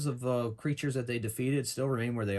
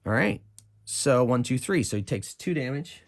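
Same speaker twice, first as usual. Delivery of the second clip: normal talking voice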